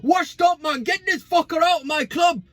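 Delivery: high, mock shouting fan voice; mocking Saeed rant. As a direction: ham up an angry fan yelling to sack him. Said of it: "it is a dig at Saeed".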